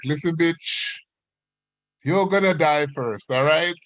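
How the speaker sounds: lightly acted card-table threat; bickering old relative. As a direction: imitate a feisty great-aunt taunting her rival. Elderly relatives bickering over cards.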